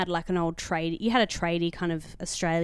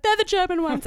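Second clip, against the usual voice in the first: anxious high-pitched reply; 12 semitones higher, 6 dB louder than usual. Retold or imitated. Anxious answer to the exterminator.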